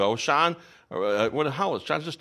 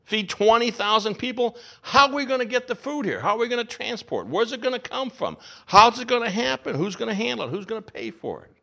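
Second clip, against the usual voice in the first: higher, fretful questions; anxious disciples; 7 semitones higher than usual. Acted. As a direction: panic a little over the logistics. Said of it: "The anxiety goes in overdrive".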